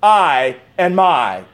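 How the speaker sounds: "I and my" spoken very slowly and firmly; slow, deliberate emphasis on the words I and my; stress on I and my. Shown as a demonstration. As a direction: lean hard on "I" and "my". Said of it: "I'm emphasizing some words here".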